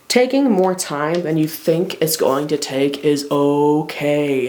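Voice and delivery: slow, deliberate stress, "okay" drawn out; slow emphasis. Real, not acted. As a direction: spell it out slowly so "okay" lands. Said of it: "I wanna emphasize that".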